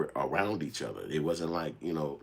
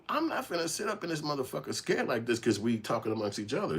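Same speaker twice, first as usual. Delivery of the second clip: put-on teen bravado; 11 semitones higher than usual. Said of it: "I'm sitting back like".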